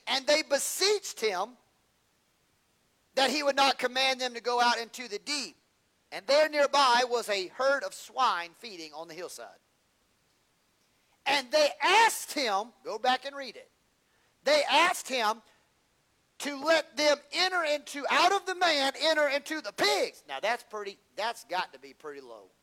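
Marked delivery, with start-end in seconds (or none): 0.1-12.7 slow, loud, high preacher's cadence; full-force preaching. Acted. Preacher reading the Legion story to the congregation, slow and booming. 14.4-20.2 slow, loud, high preacher's cadence; full-force preaching. Acted. Preacher reading the Legion story to the congregation, slow and booming.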